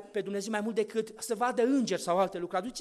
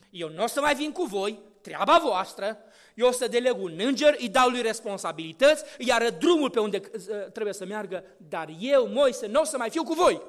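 louder stern God refusing to go; firm divine voice; 8 dB louder than usual. Acted. God tells Moses he will not come, in a louder stern voice. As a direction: declare it as an offended God.

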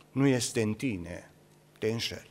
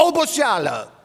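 Oboseală called out loud and higher; loud exclamation; 9 semitones higher, 4 dB louder than usual. Acted. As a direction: throw out Oboseală as a loud cry. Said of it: "loud word then quiet echo".

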